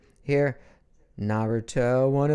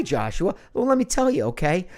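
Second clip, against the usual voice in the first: ordinary talk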